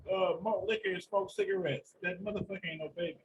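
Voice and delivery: ordinary talk, nothing marked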